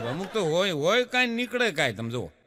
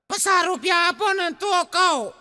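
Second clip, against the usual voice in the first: put-on high whiny child voice, half-sobbing; mock crying child; 9 semitones higher than usual. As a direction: play the bawling boy bargaining. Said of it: "રોતા રોતા". (ઊંચા અવાજે રડતા છોકરાની નકલ)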